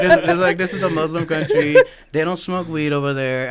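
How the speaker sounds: high, loud, laughing alarm; panicked realization. Retold or imitated. Guy realizing he sells weed, laughing in high loud alarm. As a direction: blurt it out in half-laughing panic.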